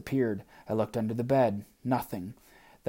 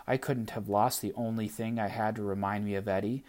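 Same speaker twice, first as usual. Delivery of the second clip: usual speaking voice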